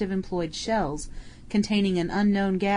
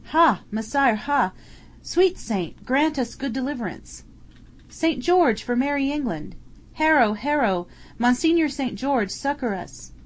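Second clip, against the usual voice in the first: high, raised calls for the saint's help; pleading battle cries; 6 semitones higher than usual. Acted. Medieval bowmen crying to their saint, high pleading shouts. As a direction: cry out to Saint George from the fray.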